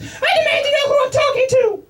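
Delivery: high demanding voice; indignant wife. Retold or imitated. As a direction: demand answers, pitched high. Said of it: "His wife".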